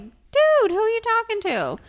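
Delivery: high, loud mock disbelief; high indignant dude, who are you talking to; incredulous dude. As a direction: call her out in shocked disbelief. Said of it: "you're like".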